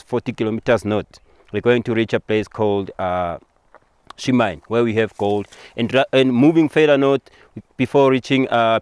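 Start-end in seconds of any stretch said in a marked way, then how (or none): none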